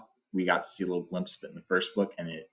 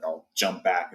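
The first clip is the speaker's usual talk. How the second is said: ordinary talk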